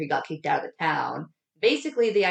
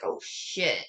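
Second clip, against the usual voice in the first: dread; 5 semitones lower, 5 dB quieter than usual. Retold or imitated. Dad hears daughter, dread.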